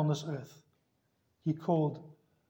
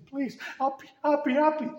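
crying plea; 9 semitones higher than usual. Retold or imitated. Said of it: "cry and weep and say".

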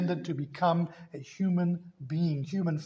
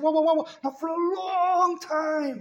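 flustered calming; 11 semitones higher, 3 dB louder than usual. Retold or imitated. Father calming panicking son.

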